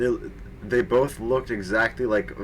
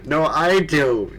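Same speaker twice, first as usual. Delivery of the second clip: higher and louder, slow hammed-up shout; mock shout; 5 semitones higher, 5 dB louder, slower than usual. A narrator's reading. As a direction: yell it back like a squabbling teen.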